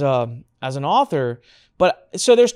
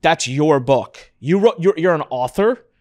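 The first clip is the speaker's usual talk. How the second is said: slow, louder, amazed questions; stunned disbelief; 6 dB louder, slower than usual. Retold or imitated. Cousin realising Anik is an author, slow amazed questions. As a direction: drag it out, floored by the news. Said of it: "She goes".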